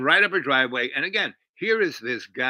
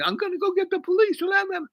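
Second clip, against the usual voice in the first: very high, hurried wailing threat; mock scream; 4 dB louder, faster than usual. Rival with broken nose threatens police, mock screaming. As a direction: imitate a frantic high-pitched screamer. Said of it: "and he's screaming".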